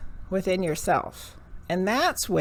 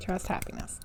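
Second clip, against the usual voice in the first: whispered; 10 dB quieter than usual. Real, not acted. Reader whispers the card's motto to herself, hushed. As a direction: whisper it, unvoiced and soft.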